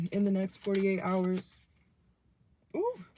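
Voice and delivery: hiccups breaking the words; hiccuping. Real, not acted. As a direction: talk through hiccups. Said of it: "sorry, I keep hiccuping".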